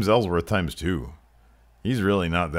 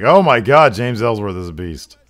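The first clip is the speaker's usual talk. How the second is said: loud, high burst of amazement; shocked commentator; 5 semitones higher, 10 dB louder than usual. Acted. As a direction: exclaim at a huge hit in the ring. Commentator reacts to big kick.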